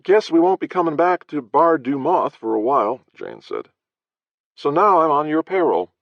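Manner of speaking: high, lively wisecracking voice; wry Jane. Acted. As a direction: tease the boss cheerfully. Jane's held character voice.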